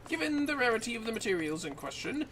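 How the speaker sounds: lightly voiced formal scholar, measured and courteous; NPC quest-giver voice. Streamer voicing the quest NPC to her co-op partner, lightly formal. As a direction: read it like a polite old-world sage.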